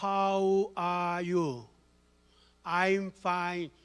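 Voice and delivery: a hint of an old woman reading English slowly; "How are you?" read out very slowly, phrase by phrase; elderly learner. Grandmother on YouTube reading written-out English, slow and careful. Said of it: "이렇게 적어가는데요".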